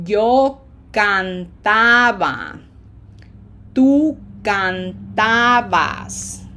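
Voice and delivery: drawn-out open A vowels, stressed syllable loud; Spanish drill, each A held long and open; slow stretched A's. Shown as a demonstration. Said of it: "Say it slowly like I do".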